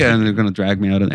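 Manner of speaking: ordinary talk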